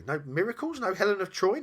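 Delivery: dismayed demon, voice climbing; incredulous. Acted. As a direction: pile up the questions in astonished high pitch. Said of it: "it asked incredulously".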